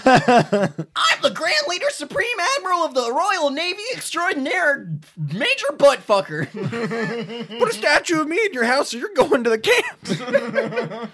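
high, grandiose tyrant proclamation; pompous dictator. Acted. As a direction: boom out your endless titles. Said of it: "dictator of a country".

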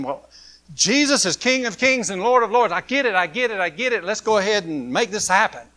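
a touch excited realisation; Mary thinking. Retold or imitated. Mary works out that Jesus is king, eager. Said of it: "she was thinking".

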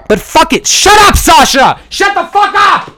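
furious high shout at a barking dog; snapping at the dog mid-podcast, furious shout; yelling at the dog. Real, not acted. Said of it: "having yelled at my dog".

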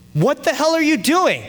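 high, loud outraged mock yell; dad exploding at the driver; imitating dad. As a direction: yell it in hammed-up disbelief. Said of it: "my dad says".

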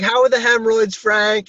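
high, chirpy pharmacist greeting. Retold or imitated. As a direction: sing it out like an overly friendly pharmacist. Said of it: "And she's like".

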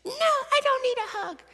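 very high mock toddler wail; imitated hysterical crying. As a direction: squeal it like a child in a tantrum. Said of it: "she's crying hysterically".

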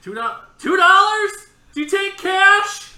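high, slow, full-volume questions; unwitting yell. Retold or imitated. Passenger asking stewardess about payment, unwitting yell. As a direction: bellow it with no idea how loud you are. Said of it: "I didn't realize I was yelling".

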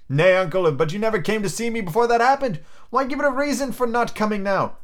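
high, pleading argument; Scrooge's nephew, protesting. Acted. Nephew reasoning with his uncle, high earnest protest.

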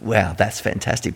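soft, smug mock delight; a quiet gloating aside; self-righteous. Listener gloating over the servant's trouble, softly smug. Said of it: "self-righteous kind of".

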